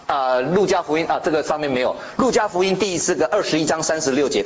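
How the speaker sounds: normal talking voice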